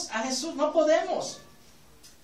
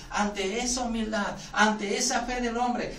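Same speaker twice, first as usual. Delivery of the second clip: usual speaking voice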